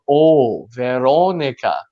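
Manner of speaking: slow "Veronica" with a long round "oh"; rounded O demonstration. Retold or imitated. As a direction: model "Oh", then "Veronica", lips rounded. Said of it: "change the shape of your mouth".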